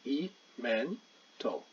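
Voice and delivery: very slow "E, mental", the vowel sound set out first; phonics demo. Shown as a demonstration. Teacher sounding out "mental" by its phonics for students. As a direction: draw out "E", then say "mental" slowly. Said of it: "here's the phonics".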